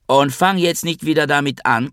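mother's acted shriek of protest; screeching. A narrator's reading. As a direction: snap indignantly in a high voice. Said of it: "kreischte Mama".

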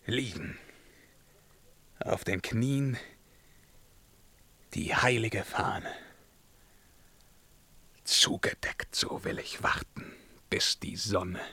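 muted trance-like murmur; hushed and fading. Acted. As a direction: let it drain away to a breath. Resigned vision of kneeling.

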